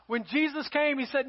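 normal talking voice

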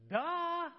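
high, loud, exaggerated duh; mock "duh". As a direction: state the obvious, loudly and mockingly. God's mocking answer, voiced.

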